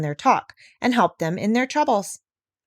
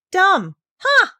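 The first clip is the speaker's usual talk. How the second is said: high, louder scoff at the word; scornful outburst; 11 semitones higher, 7 dB louder than usual. Acted. Parrot Polynesia mocking people's word for animals, scornful outburst. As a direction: spit out the insult, then snort.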